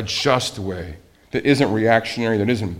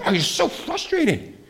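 mock exasperated voice, much higher; frustrated groan; 13 semitones higher than usual. Preacher imitating someone fed up with the news, higher mock voice. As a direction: play a fed-up churchgoer venting. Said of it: "Sometimes you just get angry".

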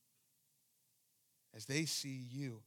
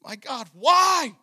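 high, slow, very loud wail; anguished full-force cry, drawn out; crying out in horror; 12 semitones higher, 25 dB louder, slower than usual. Acted. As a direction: shout it to heaven, stretching the words. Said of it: "he's crying out in horror".

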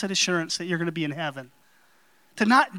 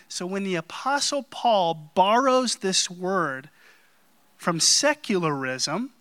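ordinary talk, nothing marked

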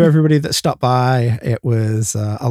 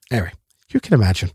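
normal talking voice

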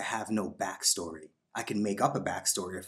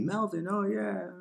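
much higher, slow mock greeting of the deli guy; 12 semitones higher, slower than usual. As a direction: imitate a cheery clerk recognizing him.

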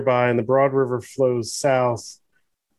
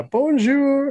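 a slow, much higher mock French accent on bonjour; 12 semitones higher than usual. Podcast hosts trading bad accents, in a mock French accent. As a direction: toss off a cartoon French bonjour. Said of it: "That's the best French accent could do".

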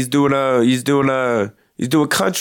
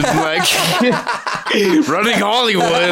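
high laugh running into the words; 6 semitones higher than usual. Real, not acted. Friend laughing at the Hollywood joke. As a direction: laugh as you say it.

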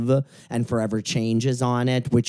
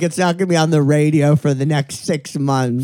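ordinary talk, nothing marked

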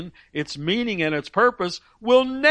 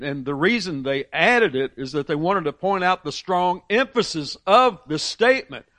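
ordinary talk, nothing marked

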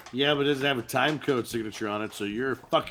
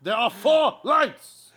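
tortured prisoner; 7 semitones higher, 4 dB louder, slower than usual. Retold or imitated. Acting torture confessions.

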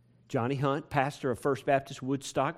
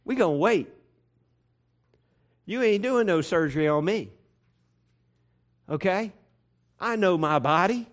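higher, louder defiant patient; stubborn refusal; 6 semitones higher, 6 dB louder than usual. Pretend. Preacher acts out what his wife could have told the doctor, loud and defiant. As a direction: dig in and refuse, loud and sure. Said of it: "She could have said".

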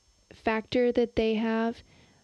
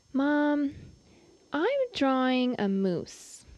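put-on child voice; 5 semitones higher, slower than usual. Son voiced in story.

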